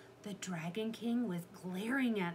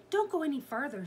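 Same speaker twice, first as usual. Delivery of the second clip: raised, loud caution; urgent warning; 8 semitones higher, 6 dB louder, faster than usual. A narrator's reading. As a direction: warn her off, pitch up. Jack warns Annie.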